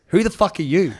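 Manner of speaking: retold angry challenge. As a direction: challenge a stranger, voice jumping up. Retold confrontation in a heated call.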